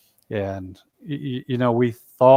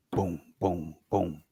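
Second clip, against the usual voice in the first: low, soft, spaced booms; deep, hushed boom sounds; imitated stomps; 5 semitones lower, 8 dB quieter, slower than usual. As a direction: mimic three distant thuds, low and quieter. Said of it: "And it responded again".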